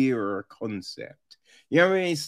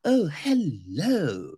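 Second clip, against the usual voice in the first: camp hello; slower than usual. Retold or imitated. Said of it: "it's just like".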